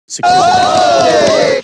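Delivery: high, loud drawn-out yell; mock shout. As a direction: belt it out as a money-hungry producer. Mock shout of the producers' motive.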